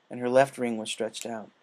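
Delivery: plain reading voice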